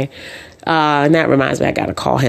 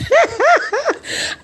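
high laugh. Real, not acted. Laughing high about going parasailing. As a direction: burst of high-pitched laughter. Laughs at her own plan.

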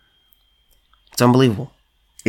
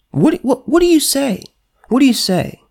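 outraged disbelief; 13 semitones higher than usual. Real, not acted. Reacting to the payout to Democrats, in high outraged disbelief. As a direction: sputter at the payout.

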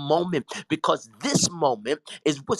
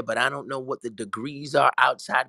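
ordinary delivery

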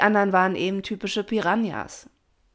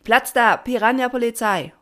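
high, loud shouted response; answering chorus; 7 semitones higher, 8 dB louder than usual. A narrator's reading. Assembled swarm answers the patron, high shouted reply. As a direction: echo it back as a crowd. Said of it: "und alle antworteten".

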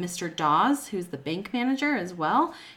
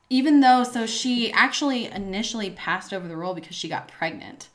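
ordinary talk, nothing marked

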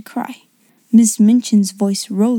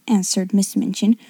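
plain reading voice

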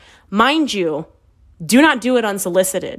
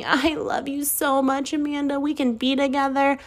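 mocking lovesick man; 6 semitones higher, 5 dB quieter than usual. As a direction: mimic a gushing declaration of love, sarcastically. Mockingly acts out the man's declaration.

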